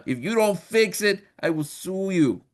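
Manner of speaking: slow, high, hammed-up Italian accent; mock Italian accent. God threatening to sue the devil, hammed-up mock Italian accent. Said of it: "why God is Italian in this joke".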